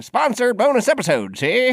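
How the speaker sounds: put-on high, bright announcer from old radio; old-timey radio voice. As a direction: play a vintage radio host. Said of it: "your little, uh, old-timey radio voice".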